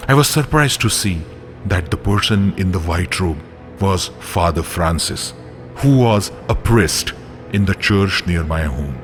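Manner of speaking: usual speaking voice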